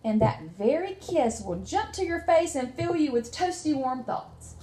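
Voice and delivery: lively read-aloud storytelling; reading to children. Acted. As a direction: tell the story to a small child, warmly paced. Teacher reads picture book aloud.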